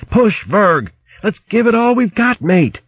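high, straining rallying cry; urgent exhortation. Acted. Captain urging mate to push under attack, urgent high cry. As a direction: drive your mate on, pitched high.

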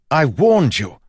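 high, loud angry shout; loud furious shout. Acted. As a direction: yell it at her in fury. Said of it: "he shouted".

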